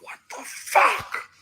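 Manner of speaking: retold high disbelieving squeak; shocked shriek. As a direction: let it fly up into a stunned squeal. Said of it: "I'm like".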